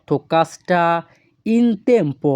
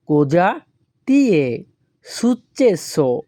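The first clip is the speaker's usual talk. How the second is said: COSA, TI È, SUCCESSO in separate pieces; word by word; much slower than usual. Shown as a demonstration. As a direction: pause after "cosa" and after "ti è". Teacher repeats it word by word.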